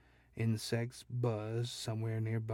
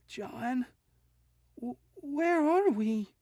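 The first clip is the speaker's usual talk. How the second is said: high, loud stammering fear; frightened wife; 6 dB louder, slower than usual. A narrator's reading. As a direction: ask it scared and lost. Wife frightened in strange land.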